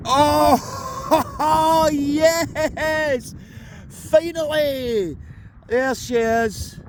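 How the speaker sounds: high, delighted cry of triumph; thrilled shout. Real, not acted. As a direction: yell out with pure joy. Finally finds silver.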